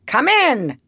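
high call to come in. A narrator's reading. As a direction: call it out brightly pitched to someone at the door. Duck calls through the door.

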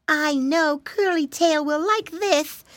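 high, slow storybook piglet voice; Flop Ear, young pig. A narrator's reading. Flop Ear choosing a toy for his brother, high and slow. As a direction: pitch it up and draw it out.

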